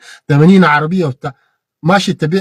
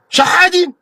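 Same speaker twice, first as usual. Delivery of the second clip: sneering "شحادين" thrown out high; scornful jab at the beggars; mocking jeer; 14 semitones higher, slower than usual. Real, not acted. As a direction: spit out the word like an insult. Mocking those who beg.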